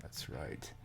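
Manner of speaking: soft whispered self-confirmation. Real, not acted. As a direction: breathe it out as a private aside. Quietly confirming his own guess.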